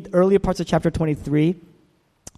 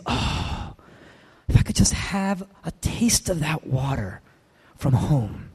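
breathy, sighing longing for home; heavy sigh; 4 dB quieter, slower than usual. Retold or imitated. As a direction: let the words sink on a heavy sigh. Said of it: "he's sighing, this heavy, deep sigh".

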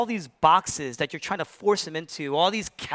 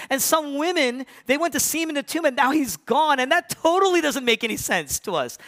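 baffled disciples; 9 semitones higher than usual. Retold or imitated. Disciples voiced, confused on the road.

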